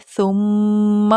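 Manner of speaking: "ثم" with the doubled میم hummed long, lips closed; held nasal ghunna. Shown as a demonstration. Teacher demonstrating doubled meem, long nasal hum. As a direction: close on the m of "ثم" and let it ring in the nose. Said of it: "آگے ثم پہ بھی میم مشدد ہے".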